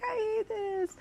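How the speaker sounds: high, teary mock wail; fake-sob it, half joking; mock crying. Telling Richard after the race, high mock sob. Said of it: "I'm crying to Richard".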